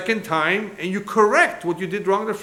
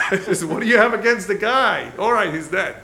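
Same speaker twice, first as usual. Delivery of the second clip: laughing; 4 semitones higher, 7 dB louder than usual. Real, not acted. Laughing at the townspeople's cursing.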